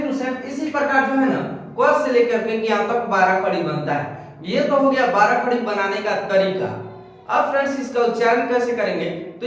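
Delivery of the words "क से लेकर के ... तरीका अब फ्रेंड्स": ordinary talk, nothing marked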